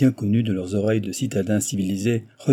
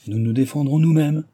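ordinary delivery, nothing marked

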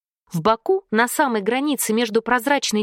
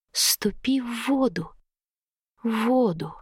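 low, slow hissing whisper; snake woman voice; 3 semitones lower, 4 dB quieter than usual. A narrator's reading. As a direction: breathe each word out like a serpent. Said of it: "Вкрадчивый голос".

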